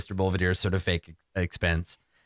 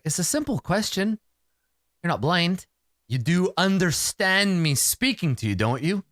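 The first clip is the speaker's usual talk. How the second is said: higher, pressing interrogation; impatient questioning; 8 semitones higher than usual. Acted. Archie questioning the captive, impatient.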